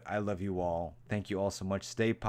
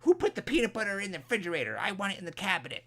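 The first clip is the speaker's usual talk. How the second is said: much higher, fast fussy complaint; petty grumbler; 10 semitones higher, 4 dB louder, much faster than usual. Retold or imitated. Imitating a sour mood after sad videos, much higher and fast. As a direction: snap like someone irritated over nothing. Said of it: "you're like".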